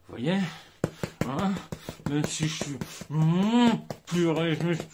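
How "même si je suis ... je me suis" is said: loud, strained, bursting with rage; pent-up anger. Shown as a demonstration. Coach shows the method that works, loud straining anger. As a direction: force the words out through rising fury. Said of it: "la méthode qui fonctionne".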